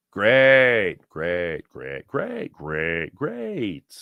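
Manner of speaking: flat sarcastic repeats, sinking; sarcastic great. Real, not acted. Fan mocking his team's bad luck, sarcastic. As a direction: drown it in sarcasm.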